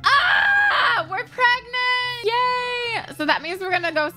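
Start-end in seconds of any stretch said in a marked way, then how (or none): 0.1-3.1 very high, drawn-out squeal; thrilled squeal. Real, not acted. Player celebrating a pregnancy, thrilled squealing. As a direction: scream with joy, then stretch it out high.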